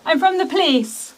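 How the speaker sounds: loud, high call through a door; apologizing through a door. Real, not acted.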